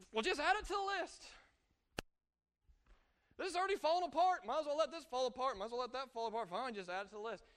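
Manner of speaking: high, loud, fed-up outburst easing off as it goes; exasperated. Acted. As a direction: throw up your hands in mock exasperation. Acting out frustrated giving up.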